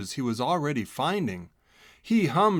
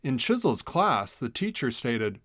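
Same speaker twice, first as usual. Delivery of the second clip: plain reading voice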